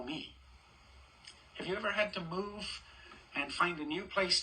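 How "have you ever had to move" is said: ordinary delivery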